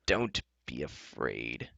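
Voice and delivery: very slow, low, soft murmur; hushed reassurance. Acted. Enchantress in Taryn's ear, softly reassuring. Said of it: "leaning over and whispering".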